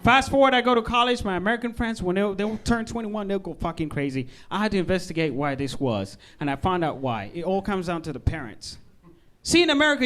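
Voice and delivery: ordinary talk, nothing marked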